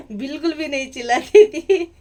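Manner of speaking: words broken by real laughter; laughing. Real, not acted. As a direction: laugh through the denial. Laughing about never shouting.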